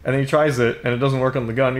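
ordinary talk

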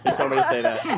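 laughing. Real, not acted. Laughing while blaming his colleague.

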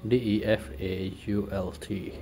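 ordinary talk, nothing marked

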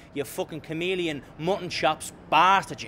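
ordinary talk

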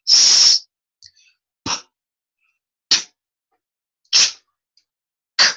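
breathy voiceless S, P, T, Ch, K; unvoiced consonants. Shown as a demonstration. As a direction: say each without voice. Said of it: "look at the unvoiced consonants".

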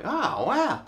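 high-pitched, imitated wow of wonder; retold amazement. Reacting to neighbours' space station news, retold amazement. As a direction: act out being impressed, pitched right up. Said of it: "And I was like".

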